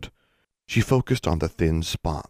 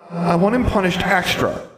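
put-on high, loud, petulant child voice; sulky ghost girl; 13 semitones higher, 5 dB louder than usual. Scowling ghost girl demanding punishment, high petulant voice. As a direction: stamp it out like a spoilt child. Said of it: "The little girl scowled".

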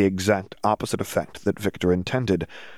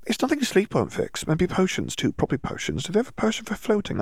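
put-on dreamy girl; 11 semitones higher than usual. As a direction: brush off worry in a floaty haze. Dazed Hermione babbling.

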